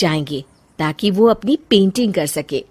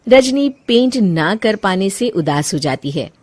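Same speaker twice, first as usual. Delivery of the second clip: normal talking voice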